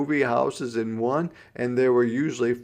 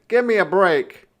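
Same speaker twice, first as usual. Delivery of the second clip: protesting; 8 semitones higher, 8 dB louder than usual. A narrator's reading. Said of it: "he protested".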